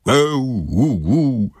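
drawn-out wordless wail; imitated mournful "eh-oo, goo-goo"; muffled hooting. A narrator's reading. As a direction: hoot helplessly as through a door. Muffled answer from the bathroom.

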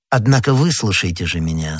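usual speaking voice

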